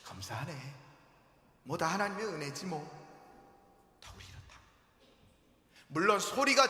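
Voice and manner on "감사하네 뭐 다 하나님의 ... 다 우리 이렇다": softer, dismissive thanks fading to nothing; mock half-hearted gratitude; offhand muttered thanks. Believers giving lukewarm thanks, soft and fading. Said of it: "큰 소리로 감사하는 자가 없다고요".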